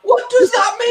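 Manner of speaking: high, incredulous mock query; exaggerated confused squeal of a question; baffled retold question. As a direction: act out her total bewilderment, pitched high. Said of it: "she's like".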